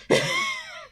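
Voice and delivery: very high, short laugh; real squealing laugh. Real, not acted. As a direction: let out a squeaky giggle. Laughs after own rant.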